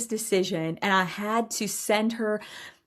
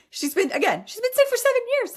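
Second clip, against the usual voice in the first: much higher and fast, sing-song brush-off; exasperated high mockery of her old excuse; mock dismissive. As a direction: toss off "sick" high and quick like it's nothing. Mocking her dismissive past self.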